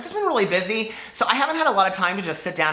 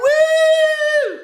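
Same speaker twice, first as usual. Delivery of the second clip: high loud whoop; big happy woo; excited whoop; 7 dB louder than usual. Real, not acted. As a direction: cheer out the goodbye. Excited sign-off.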